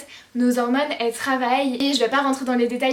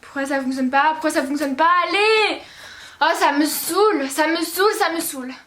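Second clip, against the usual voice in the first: building from annoyed to fed-up outburst; loud, whiny acted irritation; acted exasperation; 4 semitones higher, 4 dB louder than usual. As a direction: lose patience, pitch jumping higher. Acted exasperation in period skit.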